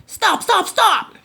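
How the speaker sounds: high, quick chittered stop; chittering mongoose. Acted. Mongoose chittering at the runaway, high and quick. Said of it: "chittered the mongoose".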